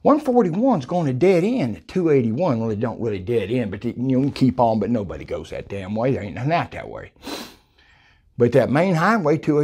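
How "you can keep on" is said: ordinary delivery, nothing marked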